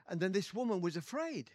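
ordinary talk, nothing marked

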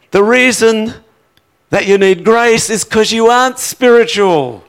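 high, loud, driving sermon voice; full-force preaching. Acted. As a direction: preach it hard to the whole room.

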